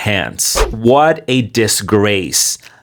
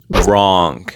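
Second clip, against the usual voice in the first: normal talking voice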